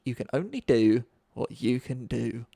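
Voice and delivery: lightly mimicking a low, slow piece of advice; mock-sage, measured delivery; quoted workplace platitude. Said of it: "every day I hear it".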